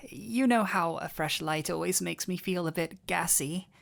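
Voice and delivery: ordinary delivery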